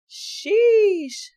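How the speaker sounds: high, loud sheesh; stunned at how long it is; sheesh of disbelief. Real, not acted. Reacting to the parole date, a loud high sheesh.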